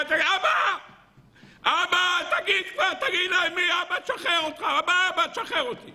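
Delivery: put-on high desperate child shouting for father; child crying out. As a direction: scream for dad like a tortured boy. Child screams to father outside. (ילד צועק לאבא בייאוש)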